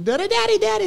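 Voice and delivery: put-on very high, loud little kid calling; Romney. Three-year-old calling Dad from the slide, excited kid voice. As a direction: squeal for Dad's attention, imitating a child. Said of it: "and he goes".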